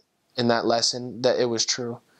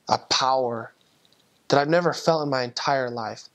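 ordinary talk, nothing marked

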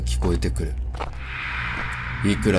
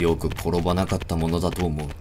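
usual speaking voice

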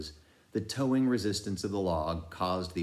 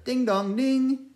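bell imitation; 6 dB louder than usual. A narrator's reading.

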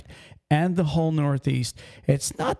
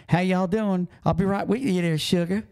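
Southern diner waitress; 6 semitones higher than usual. Acted. Trucker imitating diner staff down South, sweet Southern accent. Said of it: "you go into a diner in the South".

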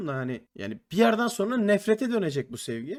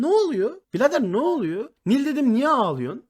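şaşkın yüksek ses; 6 semitones higher, 5 dB louder than usual. Retold or imitated. Ağlayan kıza şaşkın tepki.

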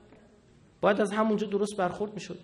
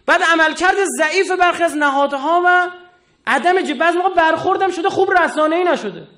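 higher, louder fiery sermon; preaching at full force; 9 semitones higher, 8 dB louder than usual. Acted. Cleric scolding the judiciary, preaching at full force. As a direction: thunder it out to the congregation.

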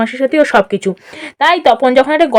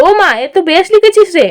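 high, indulgent back-patting tone; patronizing praise; 5 semitones higher than usual. Acted. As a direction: gush a little, then teasingly question. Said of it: "বেশ পিঠ চাপড়ানো সুরে বলল".

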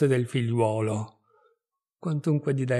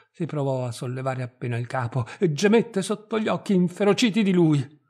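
ordinary delivery

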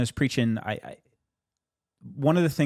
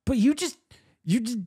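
amazed protest; 11 semitones higher than usual. Retold or imitated. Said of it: "And I'm like".

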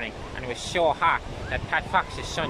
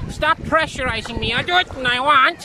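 high, loud petulant protest; comic whiny outburst, louder at the end; indignant protest; 10 semitones higher, 7 dB louder than usual. Acted. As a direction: snap back at a pushy friend. Friend pushing him into water.

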